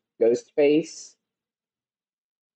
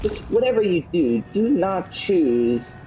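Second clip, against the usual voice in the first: dramatic warning; 6 semitones higher, slower than usual. Acted.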